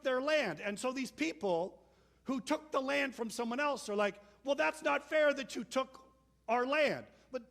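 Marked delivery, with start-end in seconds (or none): 4.4-7.1 higher, louder, whiny protest; indignant complaint. Retold or imitated. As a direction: whine that it's unfair, louder. People complaining about lost land.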